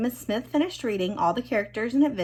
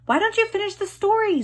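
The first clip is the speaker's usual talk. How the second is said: put-on high, louder child plea; pleading boy; 13 semitones higher, 5 dB louder than usual. A narrator's reading. Zach begging classmates to finish, high pleading. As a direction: beg them to stop as a boy. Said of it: "Zach pleaded".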